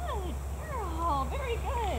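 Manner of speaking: high, soft sing-song praise; dog praise voice. Real, not acted. Trainer praising her dog Lucy, high soft sing-song.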